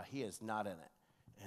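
ordinary talk

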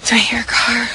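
tense whisper; hushed and alert. Acted. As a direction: whisper while listening hard. Listening for a car.

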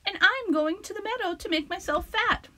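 high, tiny shy little goat; a timid, small voice. Acted. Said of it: "in his very small voice".

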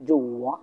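"জো ওয়া" dipping down, then rising; teacher's dip-and-rise tone on "জো ওয়া"; third tone. Shown as a demonstration. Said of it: "উপর থেকে নিচে".

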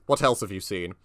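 normal talking voice

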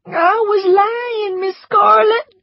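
high, slow sobbing plea; crying. A narrator's reading. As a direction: wail it out in tears. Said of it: "cried Prissy".

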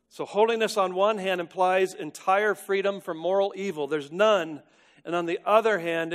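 high, loud, emphatic sermon voice; forceful preaching. Real, not acted. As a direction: proclaim it to the congregation with full conviction. Preaches God's purity with force.

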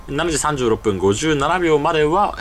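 ordinary talk, nothing marked

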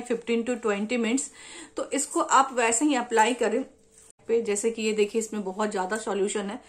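wet mask over mouth; speaking through a sheet mask. Real, not acted. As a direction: lips partly covered by mask. Seen 1: Sheet mask covers whole face, mouth opening, throughout all frames.

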